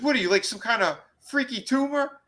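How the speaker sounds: high hammed-up accusing woman; mock high disgusted voice; high mock heroine. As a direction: tease him in a high put-on voice. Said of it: "She goes".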